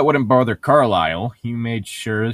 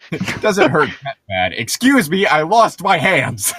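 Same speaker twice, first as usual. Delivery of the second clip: a slow, higher, hammed-up doctor complaining about his hands; mock doctor; 4 semitones higher, 6 dB louder, slower than usual. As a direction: drag it out like an offended doctor who just lost his hands. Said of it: "parodying the doctor, higher and slow".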